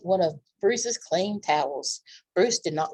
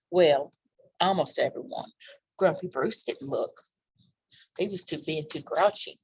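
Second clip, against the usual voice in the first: ordinary delivery, nothing marked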